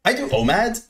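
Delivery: a stab at a high Californian bro; fruity Californian voice. Retold or imitated. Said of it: "I can't even do the voice properly".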